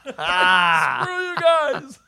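very slow, laughing and near-crying; laughing through words. Real, not acted. Laughing end of story.

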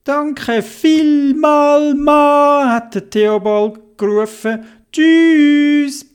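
high, loud slow thanks; Theobald thanking. Acted. Theobald thanks the crane man, high and loud.

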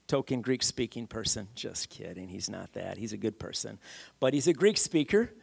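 normal talking voice

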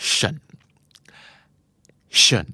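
ชั่น said as a light unstressed เชิญ; reduced shun. Shown as a demonstration. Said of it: "ออกเสียงตามครับทุกคน". (ออกเสียงเบาๆ สั้นๆ ว่า เชิญ)

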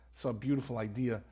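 ordinary delivery

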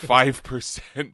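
loud, slow, drawn-out proclamation; mock announcer hype. Mimicking host's percentage hype.

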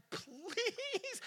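high, soft begging "Please"; pleading voice. Retold or imitated. Voicing givers who beg.

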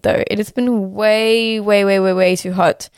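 normal talking voice

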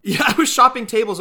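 loud laugh breaking into the line; laughing admission. Real, not acted. Cohost laughs while admitting he shopped for tables, loud and laughing. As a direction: laugh it out, a bit sheepish.

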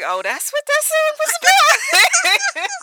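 high, loud, drawn-out dawning realization; shocked realization. Retold or imitated. Older listener finally understanding the childhood song, shocked realization. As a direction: squeal it as the penny drops.

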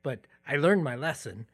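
ordinary talk, nothing marked

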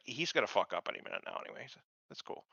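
quiet, fast, bored mutter; jaded ex-wife muttering about her husband. Retold or imitated. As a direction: mutter it like you've given up on him. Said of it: "jaded ex-wife thing down perfect".

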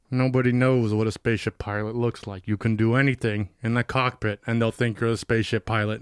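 put-on low, dry, gruff older man; a hint of a grumpy Harrison Ford.

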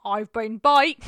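loud, hammed-up name announcement; very loud sign-off. Co-host disrupts the podcast sign-off with a loud outburst. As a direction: blurt it out at top volume. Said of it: "you're so loud".

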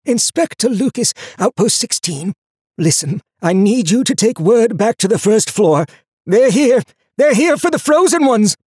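desperate warning swelling to a strangled scream; whisper rising to scream. A narrator's reading. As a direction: start as a strained whisper, climb to a panicked cry. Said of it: "risen until it was more of a scream than a whisper".

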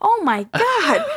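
slow, high, laughing oh my God; real amused laugh; laughing disbelief. Real, not acted. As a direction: crack up mid-phrase. Cracking up at the joke.

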